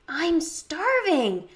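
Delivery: high, drawn-out complaint; dramatic hunger. A narrator's reading. Friend walks to the food table, dramatic whine. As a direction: stretch it out like a famished kid.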